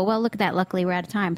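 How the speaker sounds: normal talking voice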